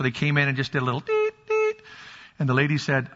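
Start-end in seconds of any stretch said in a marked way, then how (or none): 1.5-1.8 beep imitation. Imitating hearing test beep.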